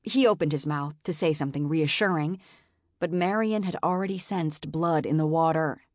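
plain reading voice

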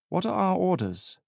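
put-on high-pitched North Wales accent. A narrator's reading. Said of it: "his distinctive North Wales accent".

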